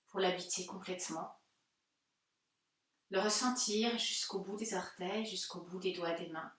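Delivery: calm, unhurried guiding voice with long pauses; guided meditation. Acted. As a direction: gently lead them inward into stillness. Leads guided Yoga Nidra relaxation.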